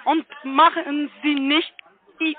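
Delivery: ordinary talk, nothing marked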